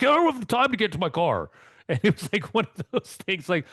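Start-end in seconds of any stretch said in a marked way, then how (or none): 0.0-1.5 annoyed traveller. Retold or imitated. Annoyed traveller quoted in story.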